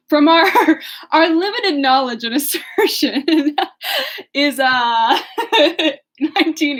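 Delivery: real laughter mid-sentence; laughing. Real, not acted. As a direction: keep breaking into giggles. Laughs at own mistake.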